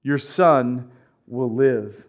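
"Your son will live" drawn out slowly and low; a slow, steady word of healing; slow solemn promise. Acted. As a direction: speak it slowly as a calm command. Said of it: "Go, back at him".

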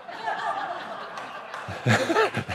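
high, breathless airy laugh at his own punchline; real laugh. Real, not acted. Pastor laughing at his own retort to the prayer group, breathy high laugh. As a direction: let a wheezing laugh escape.

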